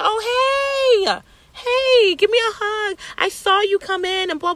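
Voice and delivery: very high, squealed friendly hello; excited greeting. Retold or imitated. Friend spots her outside and wants a hug, excitedly. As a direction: mimic a friend's high-pitched, drawn-out greeting.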